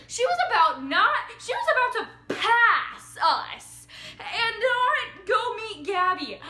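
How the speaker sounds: high, loud, drawn-out outrage; indignant disbelief. Real, not acted. Outraged that sister would pass Gabby by, indignant. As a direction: stretch the words out in exasperation.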